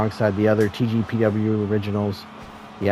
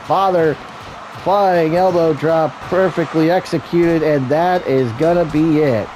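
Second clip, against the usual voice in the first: higher, driving commentator voice; excited play-by-play; 5 semitones higher than usual. Real, not acted. Wrestling commentator calls the pin and three count, excited play-by-play. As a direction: call the match finish with mounting excitement.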